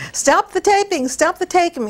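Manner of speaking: high-pitched mock yell; retold cry to halt everything; imitated yelling. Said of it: "in his undershorts yelling".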